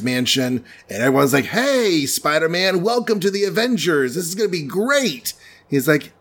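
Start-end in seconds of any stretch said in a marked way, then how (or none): 1.5-5.3 high, gushing group greeting; mock-excited welcome. The Avengers greet their new recruit, mock-excited welcome. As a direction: sing out a hammed-up welcome. Everyone welcomes Spider-Man to the team.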